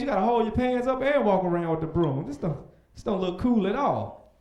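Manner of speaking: ordinary talk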